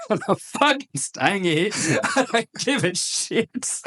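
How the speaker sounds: mock stubborn refusal, half laughing; defiant sick voice. Sick officer refusing the captain, laughing defiance.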